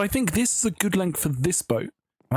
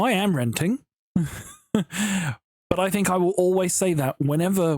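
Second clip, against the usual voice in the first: ordinary delivery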